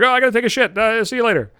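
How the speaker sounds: high, loud, hammed-up getaway line; hasty excuse. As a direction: blurt out a mock-panicked excuse. Acts his hasty excuse to escape.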